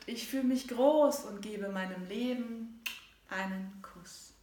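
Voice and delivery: measured, rhythmic poem recital; poem reading. Acted. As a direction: read it like a heartfelt poem. Continues reading her poem.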